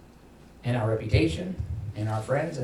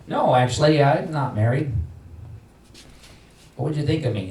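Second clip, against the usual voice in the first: pretend denial; 4 semitones higher, 5 dB louder than usual. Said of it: "if you overheard me go".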